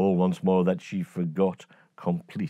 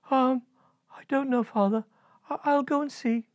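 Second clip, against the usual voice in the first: put-on high, hesitant girlish voice; high uneasy princess; nervous princess.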